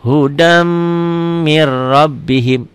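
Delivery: "hudam" hummed and held, then slow "mir rabbihim" with doubled r; held nasal merge. Shown as a demonstration. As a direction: linger on the m of "hudam", roll straight through "mir rabbihim". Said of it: "agak sedikit dengung dan ditahan". ("hudam mir rabbihim", m didengungkan dan ditahan)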